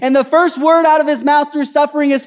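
loud, high, impassioned sermon; fervent preacher building to a cry; full-force preaching. Real, not acted. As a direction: drive it home to the congregation.

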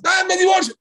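high loud mock outburst; mocking an impulsive spouse, high shout.

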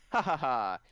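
loud, deliberate ha-ha-ha; acted villain laugh, punched out loud; villain's mock laugh. Villain's mocking laugh in story.

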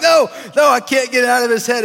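high, loud shout of desperation; acted frantic outburst; panicked shout. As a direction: cry out as if unable to escape a noise. Acting out David's panic at the voice.

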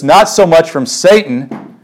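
normal talking voice

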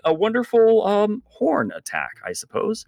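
ordinary talk

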